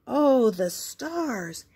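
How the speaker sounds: slow, awed gasp of wonder. Acted. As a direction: marvel at the sky slowly. Spot sees stars in sky.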